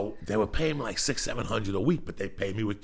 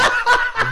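high shrieking laugh; 11 dB louder than usual. Real, not acted. Laughing hard.

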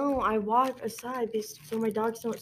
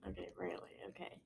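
whispering; 21 dB quieter, much faster than usual. Real, not acted. Teasing over rarely getting pimples.

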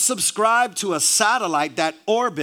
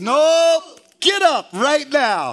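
loud, higher, insistent order; shouted command; 5 semitones higher, 6 dB louder, slower than usual. Acted. God orders the sleeper out of bed, loud and insistent. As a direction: cut off the excuse with a forceful shout.

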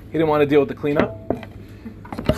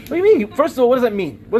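incredulous question; 5 semitones higher, faster than usual. Real, not acted. Preacher challenging the strange verse, incredulous. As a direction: burst out in disbelief.

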